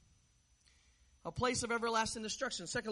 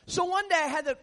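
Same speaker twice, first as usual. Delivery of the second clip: ordinary delivery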